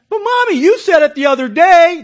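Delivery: put-on high, loud little kid protest; child voice. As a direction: play the innocent child who spills it.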